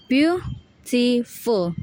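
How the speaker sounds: "beautiful" in three slow, separate syllables. Shown as a demonstration. Student repeating the syllables, "beautiful" slow and separated. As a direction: break "beautiful" into beau, ti, ful. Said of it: "the syllables are".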